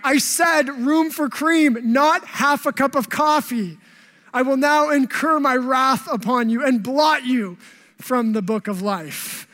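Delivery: hammed-up diva yelling at the barista; angry customer yelling at the barista over coffee, hammed-up; mock entitled outburst. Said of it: "you're like".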